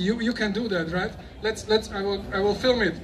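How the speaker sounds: ordinary delivery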